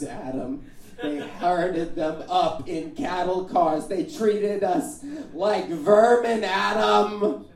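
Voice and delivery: slow guilt-trip, building to high and loud; lamenting mother. Acted. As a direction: play the aggrieved mother, dragging out each word as the outrage swells. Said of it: "To which she replies".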